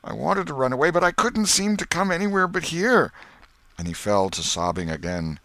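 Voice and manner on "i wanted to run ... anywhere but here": high, anguished whine; tearful plea. A narrator's reading. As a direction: plead helplessly. Potter sobbing plea.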